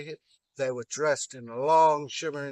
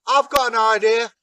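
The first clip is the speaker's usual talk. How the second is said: 1 eager Loki; 8 semitones higher, 10 dB louder than usual. Acted. Loki voiced in told story.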